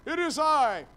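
lightly hammed-up stagey Jesus; a touch of grand stage delivery; theatrical Shakespearean. Jesus answering his frightened disciples, mildly theatrical. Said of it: "my best theatrical Shakespearean".

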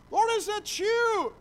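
high, slow fearful call across the water; frightened cry. Acted. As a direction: disciples crying out in fear, high and slow. Disciples crying out in fear.

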